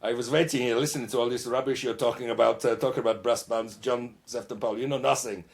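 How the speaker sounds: hammed-up German accent butting into the interview. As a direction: play Helmut, a jolly Bavarian bandleader, with a German accent. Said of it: "Yeah, Helmut gets in the way".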